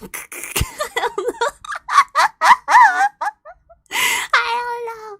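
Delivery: giggling through the words; laughing. Real, not acted. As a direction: laugh it out, can't hold it in. Laughing at the story's absurd line.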